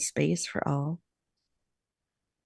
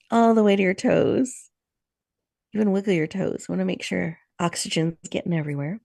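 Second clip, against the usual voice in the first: guided meditation. Acted. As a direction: lead the group gently into stillness.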